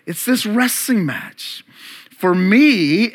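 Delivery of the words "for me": "me" stressed, louder; emphasis on me. Shown as a demonstration. Preacher stressing "me" for the congregation, louder. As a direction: lean hard on "me" in "For me". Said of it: "emphasis on me".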